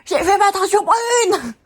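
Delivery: high, slow, loud protest; indignant outburst. Real, not acted. Protesting to viewers about her dyed hair, high and loud. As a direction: cry it out, outraged. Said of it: "je m'énerve".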